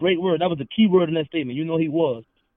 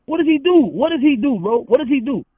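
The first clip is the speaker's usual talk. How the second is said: loud, high, insistent demand; heated challenge; 9 semitones higher, 8 dB louder than usual. Real, not acted. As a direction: keep hammering the question, loud and high. Heated challenge of rival's credibility.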